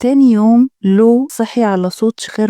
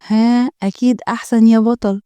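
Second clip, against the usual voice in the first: ordinary delivery, nothing marked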